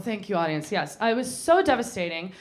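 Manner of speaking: normal talking voice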